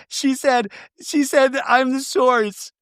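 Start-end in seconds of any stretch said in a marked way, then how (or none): none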